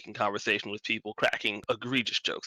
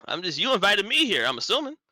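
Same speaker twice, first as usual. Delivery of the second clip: higher, animated protest; startled Githyanki; 8 semitones higher, 4 dB louder than usual. Acted. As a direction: protest in a high, swooping voice. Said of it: "the Yankee responds".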